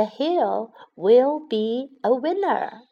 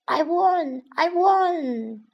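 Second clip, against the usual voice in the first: excited; 5 semitones higher, much slower than usual. Acted. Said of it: "乌龟很兴奋地说".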